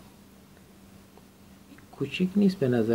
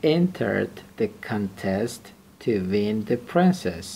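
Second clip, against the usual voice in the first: very slow, deliberate reading of the sentence; slow example reading; 3 dB louder, much slower than usual. Shown as a demonstration. Teacher reading example sentence to student, slow and deliberate. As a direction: read it slowly so students can follow. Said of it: "teacher reads example slowly".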